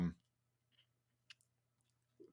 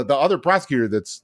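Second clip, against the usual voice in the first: ordinary delivery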